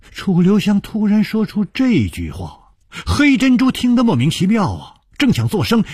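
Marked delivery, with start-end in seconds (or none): none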